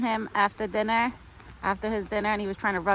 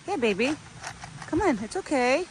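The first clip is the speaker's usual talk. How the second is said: lightly imitated, higher sweet coaxing; high coaxing pet voice; 7 semitones higher than usual. Retelling how she coaxed her deer, a high sweet coax.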